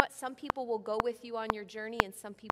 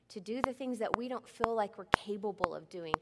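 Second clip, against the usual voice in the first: normal talking voice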